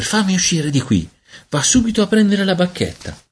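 high-pitched, urgent little hiss; sibilato. A narrator's reading. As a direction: order her in a high child's voice. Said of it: "sibilò Peter". (vocina acuta che sibila)

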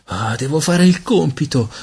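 high-pitched pleading; supplichevole. A narrator's reading. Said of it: "supplicò lui". (supplica con vocina acuta)

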